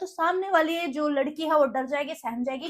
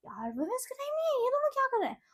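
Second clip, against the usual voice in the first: higher, softer woman complaining; other woman's complaint; 7 semitones higher, 7 dB quieter than usual. Retold or imitated. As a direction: voice another woman, annoyed. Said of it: "वो भी एक मुझसे बोलती है". (दूसरी औरत की धीमी, ऊँची शिकायत)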